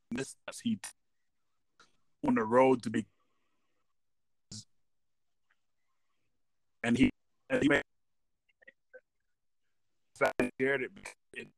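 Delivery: fading phone line. Real, not acted. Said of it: "You're, you're fading in and out".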